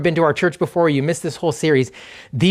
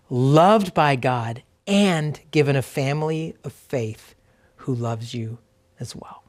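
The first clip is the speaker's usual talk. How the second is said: slow reflective reading. Real, not acted. Pastor reading truths for the congregation to remember, slow and reflective. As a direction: read slowly, with long pauses to let each truth land. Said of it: "I'm just gonna read them slowly".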